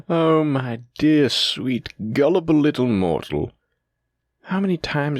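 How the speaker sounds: drawn-out sneer at a gullible victim; gloating mockery. Gloating over the tricked mortal.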